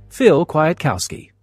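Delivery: usual speaking voice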